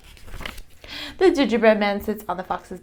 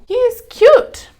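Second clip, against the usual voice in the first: Jill's line; 9 dB louder, slower than usual. A narrator's reading. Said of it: "says Jill".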